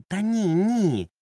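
high, loud, slowed protest. A narrator's reading. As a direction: push back hard, offended. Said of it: "запротестував".

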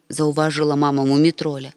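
ordinary delivery

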